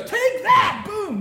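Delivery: very high, loud playful yell through laughter; boyish battle cry. Retold or imitated. Father imitating son's wrestling taunt, loud playful yell. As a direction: shout it like a kid landing a finishing move. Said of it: "And then he goes".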